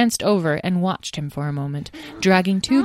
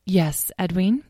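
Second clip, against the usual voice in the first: plain reading voice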